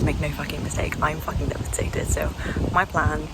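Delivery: usual speaking voice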